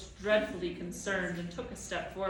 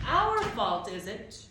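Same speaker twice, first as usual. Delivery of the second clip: loud, higher, indignant shouting; yelling back; 8 semitones higher, 5 dB louder than usual. A narrator's reading. Tom yelling at the towering Proc, furious. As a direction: hurl the questions up at him. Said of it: "he yelled at the towering Proc".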